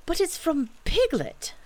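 put-on high boyish exclamation; startled, high-pitched child voice; surprised cry. A narrator's reading. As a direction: cry out on discovering who wrote it. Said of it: "cried Christopher Robin".

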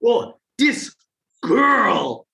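high, drawn-out mock amazed voice; imitating Koreans. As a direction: mimic Korean onlookers teasing. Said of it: "And they'd say".

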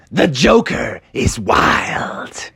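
slow, hushed whisper of "The Joker is wild"; a whispered "yell" that stays hushed; dramatic whisper. Acted. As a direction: breathe it out like a secret, slowly. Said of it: "And he yelled out".

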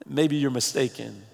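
ordinary talk, nothing marked

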